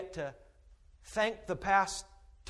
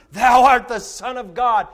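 loud proclamation; 5 semitones higher, 14 dB louder than usual. Acted. Reading worship line.

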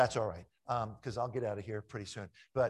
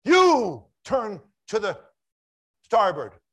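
loud, slow, defiant call back; shouted reply; 8 semitones higher, 17 dB louder, slower than usual. Retold or imitated. Lighthouse keeper calling back to the ship, loud and stubborn. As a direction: throw the order right back, loud and slow.

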